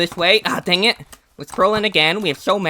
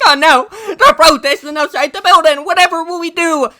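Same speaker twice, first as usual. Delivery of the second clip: high, loud, laughing whine; hammed-up panic, laughing through it; mock whining politicians; 11 semitones higher, 7 dB louder than usual. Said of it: "they go start whining and crying".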